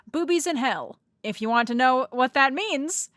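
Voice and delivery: usual speaking voice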